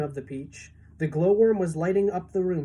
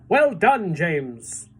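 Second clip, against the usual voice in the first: loud praising call; grasshopper's praise; 5 dB louder, much slower than usual. A narrator's reading. Old green grasshopper praising James, loud and glad. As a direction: cheer James on. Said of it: "shouted the old green grasshopper".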